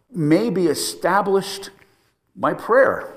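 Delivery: impassioned plea. Shown as a demonstration. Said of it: "impassioned plea".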